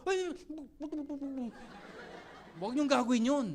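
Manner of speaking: ordinary talk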